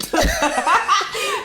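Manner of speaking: high, loud burst of laughter. Real, not acted. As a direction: crack up at the joke. Reacting to the bedroom joke.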